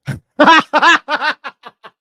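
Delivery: big helpless laugh, high and loud; real laughter burst. Real, not acted. Streamer breaking up at a viewer's joke, loud laughter. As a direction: crack up for real. Cracking up at a donation.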